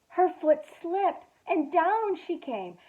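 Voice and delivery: storyteller gasping at the fall; dramatic gasp. A narrator's reading. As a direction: gasp as the bunny falls.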